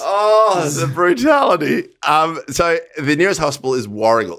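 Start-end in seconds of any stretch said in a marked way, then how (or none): 0.0-1.9 loud over-the-top lament; mock outrage. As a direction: wail it like a melodrama. Mock dramatic reaction.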